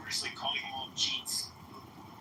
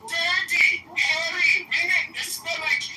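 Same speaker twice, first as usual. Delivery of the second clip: put-on louder, shouting Indian accent caricature; hammed-up Indian accent, half yelled; loud mock Indian accent; 12 semitones higher, 9 dB louder than usual. Ranting at the stranger in a loud mock Indian accent.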